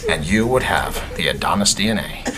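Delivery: low, hushed, hammed-up lecherous purr; mock-sleazy sweet talk, low and hushed; mock-seductive come-on. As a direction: play the creepy seducer, sinking toward a whisper. [name] playfully creeps on Stella.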